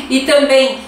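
ordinary talk

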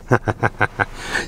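a quiet, unvoiced little laugh; soft breathy chuckle. Real, not acted. Reviewer pleased by the revving engine, soft breathy chuckle.

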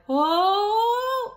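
high loud falling oh; a long mock wail; a pug falling, imitated cry. Shown as a demonstration. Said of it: "I'm thinking of like".

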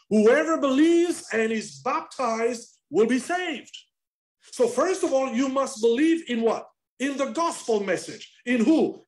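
high, loud proclamation, up to a shout; shouting preacher. Acted. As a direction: proclaim it over the whole church. Preacher shouting requirements for salvation.

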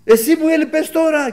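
very high, loud mock yelling; re-enacted irritated outburst, pitched right up; retold angry shouting. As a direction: imitate a stressed-out worker snapping at you. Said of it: "και φώναζε".

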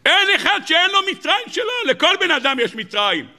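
very high preacher's cry, easing down; impassioned preaching. Acted. Preacher telling everyone they have their own Egypt, impassioned. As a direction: start at a high cry and come down.

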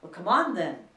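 very loud, commanding roar; booming royal command. A narrator's reading. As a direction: roar the order like an impatient queen. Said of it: "roared the Queen".

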